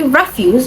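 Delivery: "refuse" stressed on the first syllable; weight on the start of "refuse". Shown as a demonstration. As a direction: say "refuse" as REF-use, the noun form. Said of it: "it is stressed on the first syllable".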